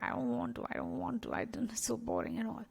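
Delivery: soft, whiny reluctance; reluctant brain. Retold or imitated. Speaker voicing own brain resisting an event, soft whine. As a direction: grudgingly mumble that you don't want to go. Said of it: "my brain was like".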